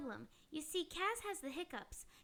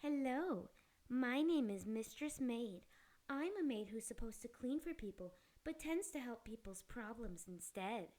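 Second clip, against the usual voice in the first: sultry maid voice; 6 semitones lower, 4 dB quieter than usual. Acted. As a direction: purr it like a flirty maid. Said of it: "I'm not really Mistress Maid".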